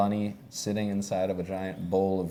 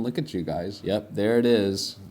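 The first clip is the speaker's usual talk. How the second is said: ordinary talk, nothing marked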